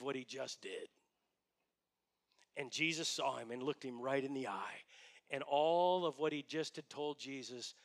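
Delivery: low, soft storytelling, sinking to a whisper; grave, fading almost to nothing; hushed and somber. Real, not acted. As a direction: bring the congregation down into Peter's shame. Hushed retelling of Peter's shame.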